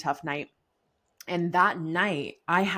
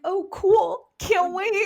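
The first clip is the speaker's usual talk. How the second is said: much higher, louder fake cheer; sarcastic; 13 semitones higher, 6 dB louder, slower than usual. Replying to mom friends about breastfeeding, sarcastic. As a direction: chirp it with mock enthusiasm.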